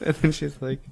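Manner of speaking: ordinary talk, nothing marked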